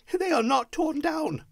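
high-pitched cry of relief; elated high exclamation; crying out, overjoyed. A narrator's reading. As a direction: exclaim it high with joyful disbelief. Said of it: "cried Scrooge".

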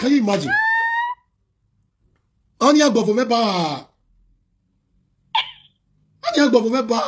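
real exasperated yell; incredulous shout. Real, not acted. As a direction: shout it in disbelief.

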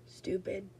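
usual speaking voice

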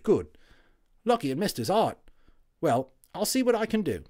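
a WWI orderly waking up chatty; friendly Cockney Tommy, loud and high; cheery Cockney medic. Acted.

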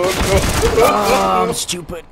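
high, loud exasperated cry; self-mocking outburst. Real, not acted. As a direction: scold yourself out loud.

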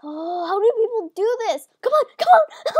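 loud wail climbing very high; high, loud despair at the game; exasperated yelling. Real, not acted. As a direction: cry out in helpless frustration. Failing repeatedly at the game.

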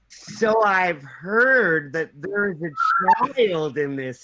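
drawn-out, very slow voice; a slow, heavy imitation of a friend; slow Kevin impression. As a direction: drag every word out slowly. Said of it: "I don't speak that slow".

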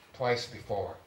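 plain reading voice

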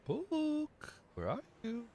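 high sing-song call to a lost pet. Pretend. Calling out for a lost book.

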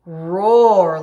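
slow, loud, roaring call. Shown as a demonstration. Teacher roaring a lion call, slow and loud. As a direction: let it out like a lion. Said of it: "lions roar very loud".

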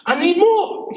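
loud, high-pitched plea; high demanding voice. Retold or imitated. Neighbor voiced, demanding more.

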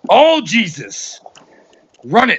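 all-out gamer shout; hyped shouting. Real, not acted.

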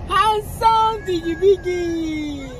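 sing-song it like adoring a newborn. Real, not acted.

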